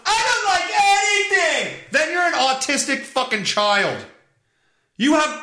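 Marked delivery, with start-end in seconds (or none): 0.1-1.8 very high, whiny caricature; hammed-up high-pitched complaint; mock complaining wife. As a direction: wail it like a fed-up wife. Mimics the wife turned against him.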